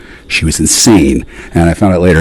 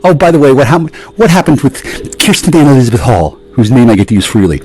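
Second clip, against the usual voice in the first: usual speaking voice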